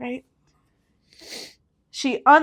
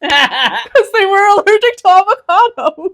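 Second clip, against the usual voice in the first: loud, high, slow laughing voice; real laughter pushing the voice up; cracking up; 7 semitones higher, 7 dB louder than usual. Real, not acted. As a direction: laugh your way through the words. Cracking up at own joke.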